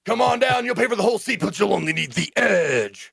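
loud, high-pitched promo bellow; hammed-up arena ad, stretching the last word; hyped event announcer. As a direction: sell it like a monster-rally commercial. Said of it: "make a very good radio D J".

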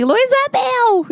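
slow, high drawn-out yell; shouting. Retold or imitated. Yelling at home during the party, slow high yell. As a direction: holler the name across the house. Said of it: "Eu só comecei a gritar assim".

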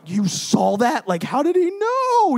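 high, loud, drawn-out disbelief; hammed-up wail of being found out; mock panic. Man sees his secret sin written, mock panic.

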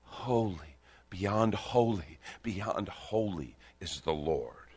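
slow, weighty beyond holy, beyond holy; amplified declaration. Shown as a demonstration. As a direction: lean into each beyond holy. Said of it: "they amplified it exponentially".

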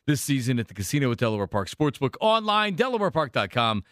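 punchy announcer-style ad read; radio sponsor read. Acted. Radio host opening a sponsored listener-text segment, announcer read. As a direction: sell the sponsor, then launch the segment.

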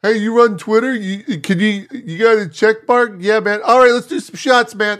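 hammed-up bar bro offering shots; drunk bar guy. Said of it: "a Vegas bar".

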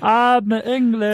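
high, slow hammed-up English accent; playful put-on English accent for laughs; mock English accent. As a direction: drag it out in a silly English accent. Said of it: "his attempt at an English accent".

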